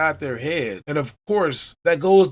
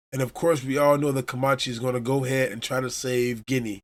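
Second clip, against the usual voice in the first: ordinary delivery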